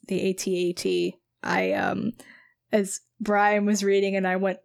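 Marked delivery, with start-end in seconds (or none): none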